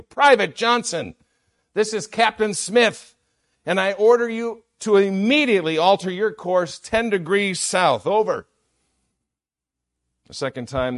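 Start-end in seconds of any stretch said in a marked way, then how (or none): 0.0-8.5 high, loud commanding shout over the radio; shouted order. Acted. Captain shouts his order at the private over the radio. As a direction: bark the order like an outraged captain. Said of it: "the captain shouted back over the radio".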